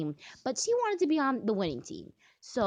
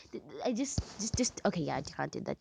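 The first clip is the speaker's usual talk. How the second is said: ordinary talk, nothing marked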